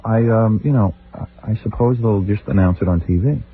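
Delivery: low, tired and dazed; headache voice. Shown as a demonstration. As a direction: answer like your head hurts. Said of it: "like I have a headache or something".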